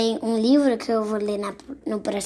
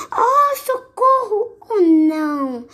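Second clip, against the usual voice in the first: high, slow, hammed-up cry of terror; the frightened cry on seeing the gruffalo, high and hammed-up; mock fright; 10 semitones higher, 5 dB louder, slower than usual. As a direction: wail as if scared out of your wits.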